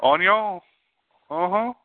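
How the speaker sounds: high-pitched Cajun catchphrase voice; mock Cajun accent. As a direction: imitate a TV Cajun cook. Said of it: "I learned Cajun from".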